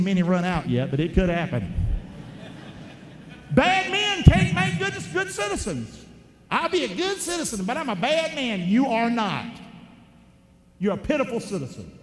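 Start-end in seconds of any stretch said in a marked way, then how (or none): 3.5-8.6 emphatic preaching. Real, not acted. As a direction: preach it high and hard to the pews. Preacher building to emphatic point.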